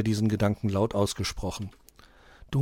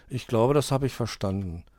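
ordinary delivery

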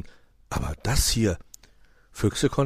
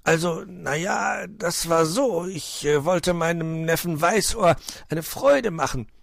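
high, slow, halting excuse; hesitant, flustered fox caught out; stammering; 10 semitones higher, 3 dB louder than usual. A narrator's reading. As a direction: stall awkwardly, dragging out the first words. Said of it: "und deshalb stotterte er".

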